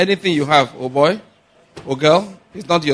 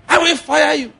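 high-pitched outraged boss; mock boss threat; 13 semitones higher, 4 dB louder than usual. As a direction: fire back as an indignant boss. Acted boss threatening to fire.

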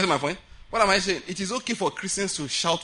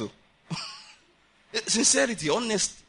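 ordinary talk, nothing marked

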